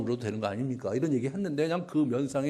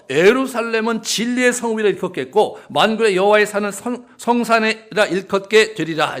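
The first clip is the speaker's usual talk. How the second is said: loud, high declaiming scripture; solemn proclamation; 8 semitones higher, 11 dB louder than usual. Acted. Preacher reading God's jealous declaration, loud and solemn. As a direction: proclaim like an oracle.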